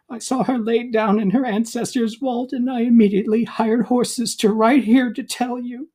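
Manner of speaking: a timid servant delivering grief; young servant bearing bad news; servant Balthasar. Servant Balthasar breaks sad news.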